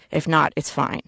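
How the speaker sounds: usual speaking voice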